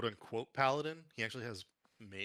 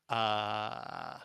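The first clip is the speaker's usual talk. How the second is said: ordinary talk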